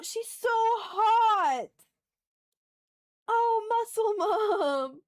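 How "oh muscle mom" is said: normal talking voice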